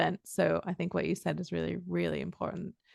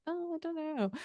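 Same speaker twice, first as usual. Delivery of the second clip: doubtful; 10 semitones higher, 5 dB quieter than usual. Retold or imitated. Doubtful about clicking with someone.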